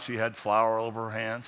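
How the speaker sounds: usual speaking voice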